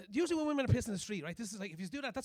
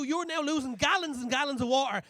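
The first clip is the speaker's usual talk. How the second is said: ordinary talk, nothing marked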